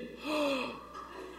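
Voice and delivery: sharp, breathy mock gasp of shock. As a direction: gasp as if the card got declined. Card rejected at pump.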